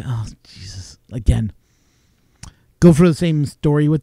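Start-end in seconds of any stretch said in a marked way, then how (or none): 0.0-1.5 hushed, low mock dread; exasperated whisper. Man getting yet another duct call, exasperated whisper. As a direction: mutter it under your breath, wearily.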